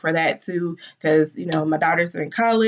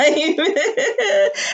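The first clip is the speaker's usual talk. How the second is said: teary laugh; 10 semitones higher than usual. Real, not acted. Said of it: "I'm trying not to cry".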